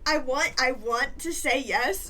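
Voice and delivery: laughing loud refusal. Real, not acted. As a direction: blurt it out, cracking up. GM refusing a silly request, laughing.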